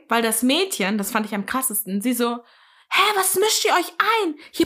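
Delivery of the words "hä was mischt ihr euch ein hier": high-pitched, affronted retort; mock indignation. Retelling the woman turning on her helpers, high indignant protest. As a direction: imitate a woman indignantly rejecting help.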